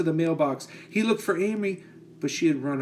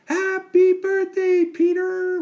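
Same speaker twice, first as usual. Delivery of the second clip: very high, loud, slow squawking parrot; 7 dB louder, slower than usual. Acted. The parrot repeats Amy's greeting, loud high squawk. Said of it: "repeated the parrot".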